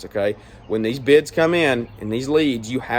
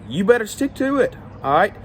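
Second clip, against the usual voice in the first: fired up; 7 semitones higher than usual. Real, not acted. Lawn care owner lecturing new business owners, fired up and insistent. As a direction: pitch jumps high, hammering the point home. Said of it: "I know I'm getting fired up".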